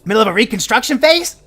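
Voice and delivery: put-on high, loud grumpy old gnome scolding; a crotchety gnome hollering at the adventurers for showing up late; cranky old gnome yelling. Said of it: "He goes".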